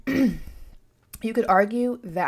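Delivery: chewing while talking; words round a bite of food; mouth full. Real, not acted. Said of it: "I'm chewing".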